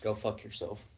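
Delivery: low, soft dismissive curse; muted contempt. Real, not acted. Fan's verdict on the magic holy water, quietly contemptuous. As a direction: drop it quietly, under your voice.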